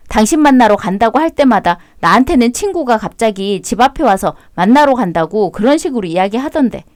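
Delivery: normal talking voice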